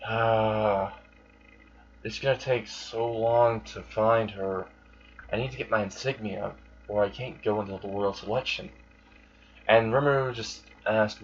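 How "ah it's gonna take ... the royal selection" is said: soft, sighing lament; weary sigh. Retold or imitated. Amelia frets over her lost insignia, mildly weary sigh. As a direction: open on a sigh, then trail on quietly. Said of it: "Amelia just sighed and said".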